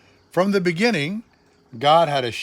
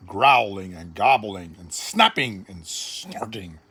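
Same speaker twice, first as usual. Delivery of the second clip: much lower, growling reading; growly animal voice; 8 semitones lower than usual. A narrator's reading. Noisy animal sounds evoked.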